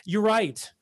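ordinary talk

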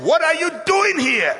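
high-pitched ringing question; God's challenge. Acted. God questioning Elijah's self-pity, challenging. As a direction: challenge them, pitched well up. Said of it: "And God is saying".

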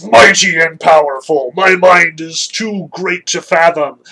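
loud, drawn-out pompous proclamation; mighty Zeus. Acted. Zeus showing off before Hera, grand and pompous. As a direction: boast like an all-powerful god. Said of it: "here's Zeus".

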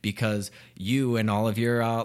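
ordinary delivery

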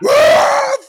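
very loud, very high mock dog howl; imitated dog outburst. Dog spots the dead ferret, imitated frenzied howl. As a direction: screech like a dog going berserk. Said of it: "The dog goes".

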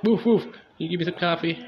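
a lazy dog asking for coffee. Retold or imitated.

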